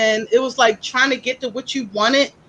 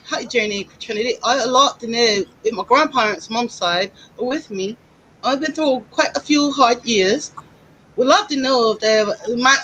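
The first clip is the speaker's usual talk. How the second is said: attempted English accent, badly done; put-on English accent, reading aloud. Shown as a demonstration. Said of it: "I'd like to read this with an accent".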